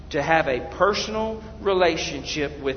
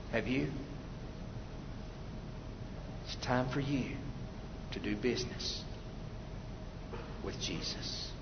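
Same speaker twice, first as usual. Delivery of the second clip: soft, low, solemn appeal; hushed altar call; 7 semitones lower, 13 dB quieter than usual. Real, not acted. Preacher invites listeners to do business with Jesus, hushed appeal. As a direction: lower the voice and invite them gently.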